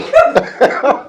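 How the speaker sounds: a high burst of laughter. Real, not acted. Laugh inside retold punchline.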